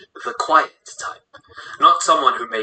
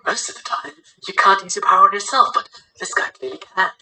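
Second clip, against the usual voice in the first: high-pitched character voice; young teammate's voice; 8 semitones higher than usual. Acted. Teammate discusses the enemy's power, high-pitched voice. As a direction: lift the whole line into a high voice.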